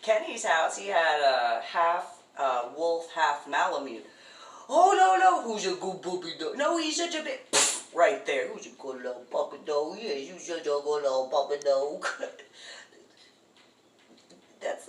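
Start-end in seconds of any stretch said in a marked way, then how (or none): 4.7-7.4 high, loud gushing puppy talk; talking to a dog. Retold or imitated. Baby-talking to wolf-dog. 8.5-12.1 put-on quick cooing baby talk; talking to a dog.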